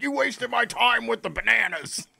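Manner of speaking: high-pitched hammed-up outrage; mock fury. Comedian acts out someone mad at a knock knock joke, mock fury. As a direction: play furious at a silly joke, voice jumping up high. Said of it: "It's like getting furious about it at the end".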